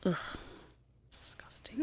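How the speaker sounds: breathy disgusted whisper. Real, not acted.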